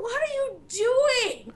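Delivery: high, slow disbelief; incredulous. Real, not acted. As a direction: drag it out in disbelief. Incredulous question to the character.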